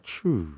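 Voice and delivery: soft, low whoosh of a car cutting out; hushed "choo" as the engine dies; imitated power-down. Imitates electric car shutting off.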